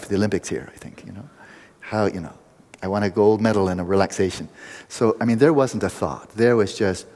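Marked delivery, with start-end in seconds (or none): none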